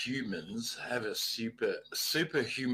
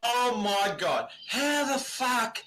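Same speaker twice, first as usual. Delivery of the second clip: excited; 6 semitones higher than usual. Real, not acted. Excited drunk exclamation.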